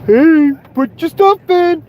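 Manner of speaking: high, slow, doofy mock of the mayor; slow, high parody of de Blasio telling people to bring things in. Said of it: "You just hear this fucking idiot?".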